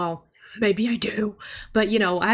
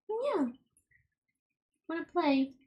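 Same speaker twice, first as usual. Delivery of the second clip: high, small cooing voice; squeaky-sweet talk to a pet; 9 semitones higher, 4 dB quieter than usual. Real, not acted. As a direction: ask the kitty in a high little voice. Baby talk to her cat.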